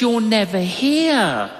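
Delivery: hint of a teasing lady who calls him out; teasing church lady. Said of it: "She goes".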